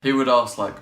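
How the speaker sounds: ordinary talk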